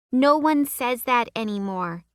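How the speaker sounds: put-on high, lightly lecturing girl; a young girl correcting with a smile; lecturing little girl. A narrator's reading. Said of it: "Rose lectured him".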